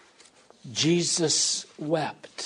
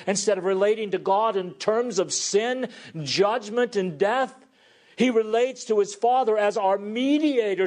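higher, building proclamation; rising sermon climax; 5 semitones higher than usual. Acted. Preacher lifting the congregation toward hope, building. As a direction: lift the list to a triumphant peak.

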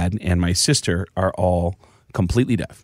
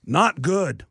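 deadpan it in a lightly put-on rock star voice; 7 semitones higher than usual. Prank caller answers the phone as [name], mock gloomy. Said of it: "And I went".

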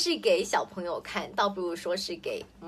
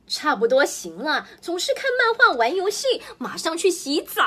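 much higher and louder nagging; fed-up order; 7 semitones higher, 5 dB louder than usual. Acted. Mother ordering her child off comics and games, loud fed-up nag. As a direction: lay down the law, fed up.

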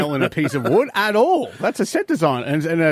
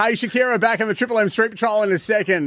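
upbeat breakfast radio read; bright morning radio host voice; radio announcer; 5 semitones higher than usual. Acted.

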